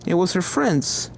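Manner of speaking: usual speaking voice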